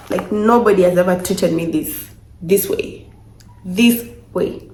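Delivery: normal talking voice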